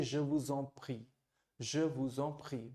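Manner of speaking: Je vous en prie said slowly and softly; French pronunciation. Shown as a demonstration. Teacher models a formal reply for students. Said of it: "तो आप कहेंगे".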